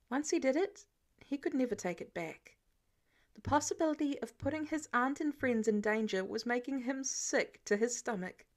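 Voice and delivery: usual speaking voice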